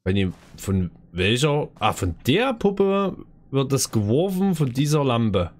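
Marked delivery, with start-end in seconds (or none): none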